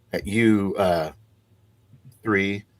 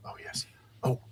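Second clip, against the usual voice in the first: very soft, whispered reply; hushed confidential murmur; whispered aside; 8 dB quieter, faster than usual. Acted. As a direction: lean in and whisper it. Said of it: "he leans in and whispers".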